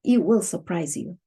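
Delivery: ordinary delivery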